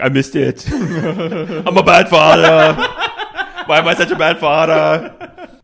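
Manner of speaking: slow, laughing mock self-pity; hammed-up guilty father, giggling; mock bad father lament. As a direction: wail it through laughter, slowly, like a guilty dad. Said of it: "He's like".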